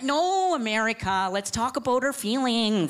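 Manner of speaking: mild high-pitched earnest plea; earnest Canada. Acted. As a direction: soothe like a therapist friend. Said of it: "he's like".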